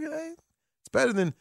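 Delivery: ordinary talk, nothing marked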